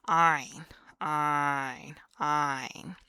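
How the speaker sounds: "Ein" loud, squeezed from the throat; throaty ein. Shown as a demonstration. Teacher demonstrating the ein sound, loud. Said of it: "You really gotta".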